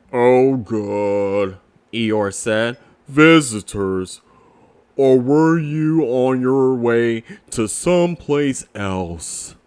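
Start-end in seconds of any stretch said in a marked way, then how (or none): 0.1-1.6 low, glum donkey voice; gloomy Eeyore. Acted. Eeyore greets his visitors, low and gloomy. 3.1-9.6 low, glum donkey voice; gloomy Eeyore. Acted. Eeyore greets his visitors, low and gloomy.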